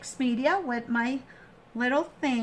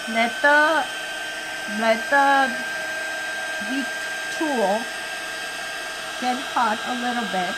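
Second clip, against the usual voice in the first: machine noise behind; 4 dB louder than usual. Real, not acted. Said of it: "Sorry for the noise of the machine".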